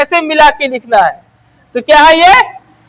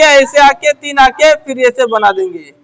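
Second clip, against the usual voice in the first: ordinary talk, nothing marked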